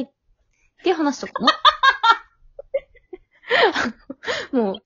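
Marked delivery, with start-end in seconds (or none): 1.5-2.2 bright burst of laughter. Real, not acted. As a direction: laughs out loud. Laughs at own story. (笑い声)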